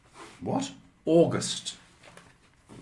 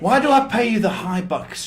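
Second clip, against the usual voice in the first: loud, high mock outrage; mock scolding; 8 semitones higher, 9 dB louder than usual. Boss ribbing co-host over a botched question, mock scolding. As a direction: pretend to tell off your hapless assistant.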